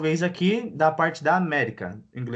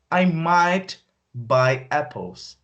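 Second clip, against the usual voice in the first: very slow teacher read; slow teaching voice; slow model sentence; much slower than usual. Shown as a demonstration. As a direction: spell it out slowly. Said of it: "slow model take".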